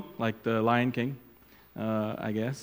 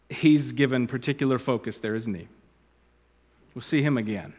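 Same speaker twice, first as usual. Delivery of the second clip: ordinary talk, nothing marked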